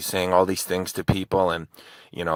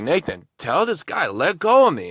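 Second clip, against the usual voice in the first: calling out; 9 semitones higher, 5 dB louder than usual. Retold or imitated. Calling friend for help.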